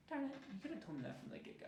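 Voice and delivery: high, soft mock grumble; muted frustration. Agent reacting to a client cancelling, softly voiced mock frustration.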